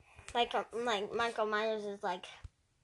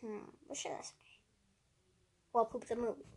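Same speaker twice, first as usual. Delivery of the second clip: much softer whisper while thinking; whispered to himself; 7 dB quieter, faster than usual. Real, not acted. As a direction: whisper it so nobody hears. Thinking to himself, dad listening.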